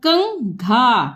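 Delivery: कंघा drawn out part by part; slow sounding out. Shown as a demonstration. Teacher to pupils, sounding out कंघा. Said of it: "जैसे ये हम दिखा रहे हैं".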